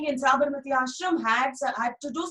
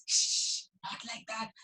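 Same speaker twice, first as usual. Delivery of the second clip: whispered shh, then softer; hushing mother; 6 dB quieter than usual. Acted. Mother hushing Dhani to correct him. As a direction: hush him gently.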